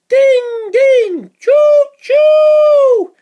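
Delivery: high loud ding ding then whistle; high loud train noises for the kids; train bell and whistle. Acted. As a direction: ring and toot like a toy train.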